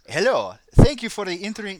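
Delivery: put-on abandoned try at a Swedish accent. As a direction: start reading in a Swedish accent, then give up. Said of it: "I'm not gonna even try a Swedish accent".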